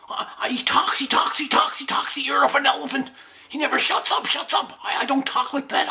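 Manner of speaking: high-pitched comic exasperation; worked-up rant. Acted. Fighter complaining about Freddy the Bear, high-pitched rant.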